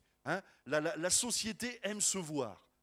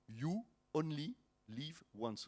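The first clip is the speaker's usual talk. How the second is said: softer, slow English accent, word by word; showing off English accent; 3 semitones lower, 5 dB quieter, slower than usual. Shown as a demonstration. Pastor showing the congregation his accent, careful softened English. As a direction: separate each English word, softly displaying the accent. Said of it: "Vous avez vu mon ang-, mon, mon accent".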